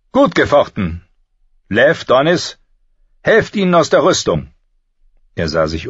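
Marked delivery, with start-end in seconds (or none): 0.1-4.4 raised, louder commanding voice; calling orders. A narrator's reading. Master-at-arms ending the bout, calling orders. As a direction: call out higher and louder to the men.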